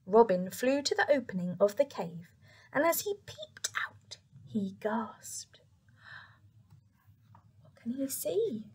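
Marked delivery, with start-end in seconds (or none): 2.7-8.7 suspenseful whispered narration; hushed storytelling. A narrator's reading.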